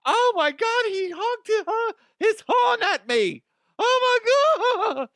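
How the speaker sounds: very high, loud, shrieking indignation; mock outraged lady. Mocking the lady blocking traffic, very high and loud. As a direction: ham up an offended driver. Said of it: "Like the lady today that was blocking traffic".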